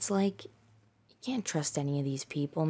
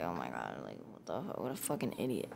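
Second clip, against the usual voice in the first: muttered to herself, much softer; under the breath; 10 semitones lower, 11 dB quieter than usual. Retold or imitated. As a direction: keep it inside, barely voiced. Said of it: "I just didn't say anything".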